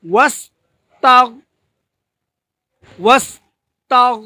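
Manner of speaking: ওয়াস্তাগ with the গ stopped and held, no bounce; held consonant. Shown as a demonstration. Teacher shows the held গ at the end of ওয়াস্তাগ. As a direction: close off the গ in ওয়াস্তাগ and hold it. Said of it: "বলা যাবে না".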